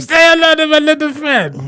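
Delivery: high mock Cuban accent of Tony Montana; mock Scarface accent. Co-host teasing about Scarface, mock Cuban accent. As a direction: ham up the Scarface line in a fake Cuban accent. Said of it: "horrible accent through the whole".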